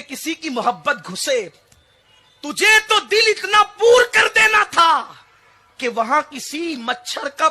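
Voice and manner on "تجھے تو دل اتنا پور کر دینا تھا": higher, louder, driving the point home; fervent preaching shout. Real, not acted. Preacher rebuking mothers before the crowd, ringing shout. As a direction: cry it out to the congregation.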